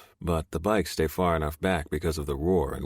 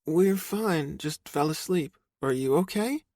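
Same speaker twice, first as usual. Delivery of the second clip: higher woman's voice, lightly voiced; sleepy girlfriend; 12 semitones higher than usual. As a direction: girlfriend on the phone reassuring her man, higher.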